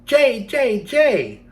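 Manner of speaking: blue jay cry. Acted. Storyteller imitates the blue jay's call, high and loud. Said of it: "the bird who cries".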